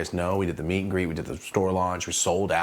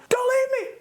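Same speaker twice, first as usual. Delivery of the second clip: very high mock-desperate cry; desperate plea; 4 dB louder than usual. Shut out behind his friend, high desperate cry. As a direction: squeal it after someone leaving.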